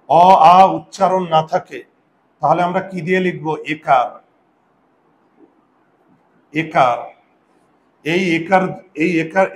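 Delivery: ordinary talk, nothing marked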